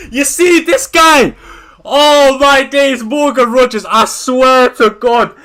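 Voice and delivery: loud exasperated yelling at the screen; real fury, loud and drawn out; angry outburst. Real, not acted. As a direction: blow up in real frustration. Furious rant about a footballer.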